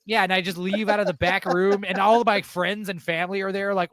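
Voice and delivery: ordinary talk, nothing marked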